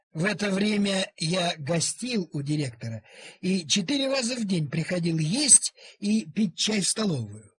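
plain reading voice

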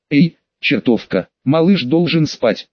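usual speaking voice

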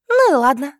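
high, loud huffy concession; petulant. A narrator's reading. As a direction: give in like a cross little sister. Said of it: "вздорно ответила сестрёнка". (вздорно, высоко и громко)

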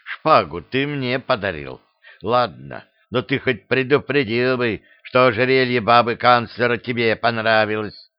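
usual speaking voice

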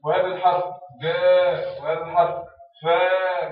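جاء and فاء said loud and slow; slow modelled letter names. Shown as a demonstration. Teacher modelling letter names to pupils, loud and slow. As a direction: model each letter name clearly. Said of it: "teacher models letter names".

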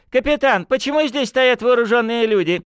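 shrill high-pitched bluster; high, loud, flustered official; panicky high voice. Acted. Panicky colonel shouting orders.

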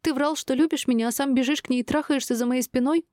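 high-pitched indignant tirade; betrayed wife's outburst; tearful accusation. A narrator's reading. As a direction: accuse him in a high, upset voice. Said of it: "сквозь слёзы заорала Шинар".

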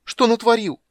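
high, loud despairing cry; helpless cry. A narrator's reading. Kender cries out helplessly on his knees, high and loud. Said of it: "беспомощно вскричал он".

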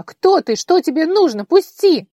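very high, loud panicked screams; terrified scream. Acted. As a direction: shriek in panic, fighting him off. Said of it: "срывающимся голосом крикнула она".

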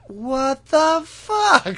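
very slow, high, loud outburst; hammed-up bewildered cry; mock stunned disbelief. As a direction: stretch each word in comic shock. Sokka's stunned reaction, acted out.

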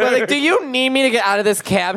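high-pitched retold disbelief; mock incredulous. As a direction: ask the cabbie in high, mock-exasperated disbelief. Retold exasperated question to cab driver.

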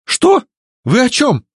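very high, frantic pleading; obsequious pleading. A narrator's reading. Lord Azavi denies the plot to his captors, pleading. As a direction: plead innocence in a loud, squeaked-up voice. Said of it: "уже подобострастно молил лорд Азави".

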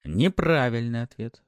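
ordinary delivery, nothing marked

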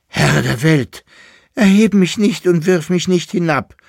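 put-on high, loud burst of a boy's prayer; sudden fervent cry. Boy in the dust pile cries out to God, loud and fervent. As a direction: cry out to heaven over a found treasure.

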